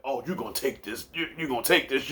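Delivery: low, hushed mock panting talk; imitating out of breath. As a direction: imitate a winded man, softly and low. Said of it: "sounded like he was out of breath".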